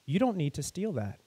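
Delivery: gentle voice. Acted. The stranger to the girl caught stealing, gentle and soft. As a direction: kind stranger quietly stopping a hungry girl stealing, softly. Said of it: "a man with a gentle voice".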